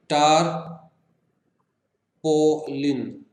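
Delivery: टारपोलिन very slowly as टार, पो, लिन; long pauses between the syllables of टारपोलिन; three-syllable split. Shown as a demonstration. Teacher demonstrating three syllables, टारपोलिन in three slow pieces. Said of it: "three syllables का है".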